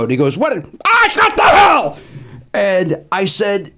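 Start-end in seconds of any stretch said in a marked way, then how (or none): 0.4-2.0 high, loud, slow yell; Shelley yelling at the comic over the coat; angry outburst. Retold or imitated. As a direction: blow up at him. Angry old comic retold.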